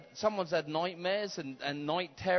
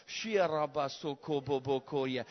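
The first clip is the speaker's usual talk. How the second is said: speaking in tongues; 5 semitones lower than usual. Acted. Tongues burst in prayer.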